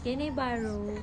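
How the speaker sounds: tearful, soft begging; crying plea. Real, not acted. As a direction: whimper the request through tears. Child crying for charger.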